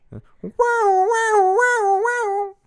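high wailing two-tone siren; British fire alarm. Pretend. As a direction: wail like a British fire engine. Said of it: "like a British fire".

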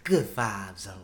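lightly voiced woman, low and soft; a hint of a lady saying it; man doing a woman's voice. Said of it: "That's the lady voice".

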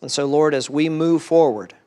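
soft, reverent closing prayer. Acted. Pastor closing the sermon in a soft, reverent prayer to God. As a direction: pray quietly over the congregation.

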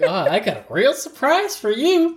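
high, hammed-up taunt; Jack. Villain husband taunts wife from the freezer, mock gloating. Imitating Jack's menacing taunt.

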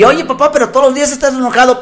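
put-on child's complaint.

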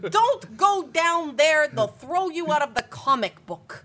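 slow, loud, high-pitched comic-book showman; Stan Lee. Acted. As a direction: drag out a hammy warning as an impression. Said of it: "how he talks, though".

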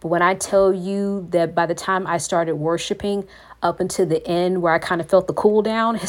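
ordinary delivery

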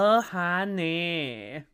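slow drawn-out mock Swedish accent. Co-host tries Trixie's line in a Swedish accent. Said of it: "imagine she did her Swedish".

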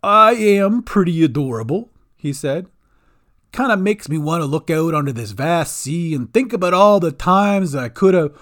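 loud, puffed-up goblin boast; the goblin shows off to his friends, swaggering; proud goblin. A narrator's reading. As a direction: strut it like a vain little goblin. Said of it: "stood tall and put his hands on his hips".

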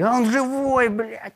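high surprised outburst, then dropping; startled, half amused; mock surprise. Captors find the prisoner alive after the blast, surprised.

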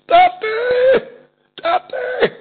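very high, long drawn-out scream of a cry; calling out to Father. Retold or imitated. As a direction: scream it out high, stretching the word. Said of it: "אויף דער אויערן געשריגן". (הויך אויסגעצויגן געשריי)

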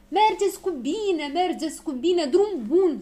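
high, loud drawn-out send-off; shouted farewell. A narrator's reading. Crowd calls up to the departing balloon, shouted farewell. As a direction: call goodbye up to departing travellers. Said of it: "strigară piticii".

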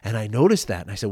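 usual speaking voice

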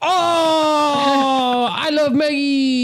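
very high, drawn-out wailing caricature; Feng Chao impression. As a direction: wail it out high and slow. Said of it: "this is my impression of Feng Chao".

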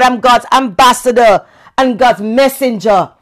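loud, high full-force preaching. Acted. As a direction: preach it out with fire.

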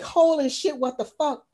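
high-pitched stunned swearing; mock shock. Host voices the mother finding ants on her son, mock horror. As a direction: blurt it high, acting appalled.

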